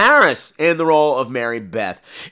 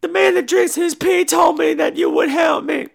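high mock girl; 13 semitones higher than usual. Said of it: "she shows up at Tony Todd's shop going".

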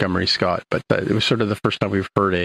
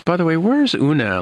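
raised questioning quote; 8 semitones higher than usual. Retold or imitated. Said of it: "he says".

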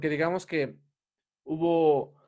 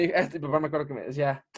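normal talking voice

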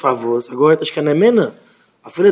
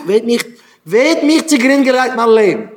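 high-pitched, acted outcry of complaint; mock anguished complaint; aggrieved protest; 6 semitones higher, 4 dB louder than usual. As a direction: cry out in exasperated protest, voice raised high. Voices an aggrieved person protesting.

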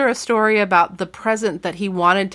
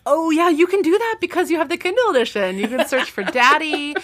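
much higher, bright and eager; excited; 8 semitones higher than usual. Real, not acted. Host excitedly telling cohost she can search the Kindle, lifted up high. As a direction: jump on the idea with glee.

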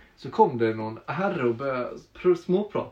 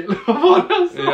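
higher, louder, through laughter; laughing; 12 semitones higher, 8 dB louder than usual. Real, not acted. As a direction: ask it while cracking up. Laughs over own retelling.

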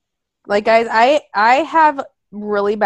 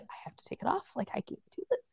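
panicked whisper; 19 dB quieter, much faster than usual. Retold or imitated. Struggling for air in a mask, panicked whisper. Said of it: "clawing at the side of my mask".

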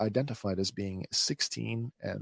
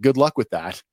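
understated sarcastic send-off, slightly louder; 3 semitones higher, 6 dB louder than usual. A narrator's reading. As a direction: toss it off with light sarcasm. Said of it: "offers a sarcastic".

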